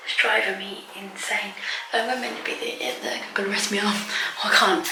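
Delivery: hushed whisper, hiding away; whispering while hiding. Real, not acted. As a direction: whisper so the family won't hear. Hiding in bathroom from family.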